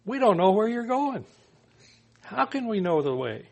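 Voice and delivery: high puzzled disciple voice; Thomas asking. Retold or imitated. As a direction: lift it up like a confused disciple. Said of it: "Thomas speaks up and says".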